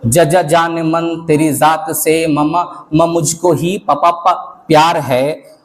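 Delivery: stuttering on each first sound; stammerer's love. Acted. Poet voices a stammering lover's confession. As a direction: stammer through every word like a shy lover. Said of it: "हकले का प्यार".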